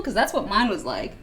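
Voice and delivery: normal talking voice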